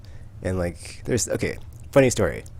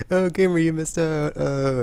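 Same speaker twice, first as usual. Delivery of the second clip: higher, slow sing-song taunt; teasing; 9 semitones higher, 4 dB louder, slower than usual. Retold or imitated. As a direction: rub it in with a playful drawl. Teasing friend who skipped.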